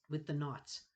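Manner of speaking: ordinary delivery, nothing marked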